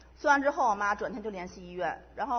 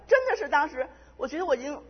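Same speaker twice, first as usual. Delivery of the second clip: usual speaking voice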